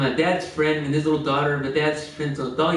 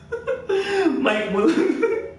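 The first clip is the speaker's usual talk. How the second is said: long high laugh. Real, not acted. Laughing at the fake ad.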